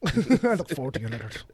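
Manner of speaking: laughing Scottish echo; light Scottish accent, laughing. Retold or imitated. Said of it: "the great Scotsman Craig Ferguson".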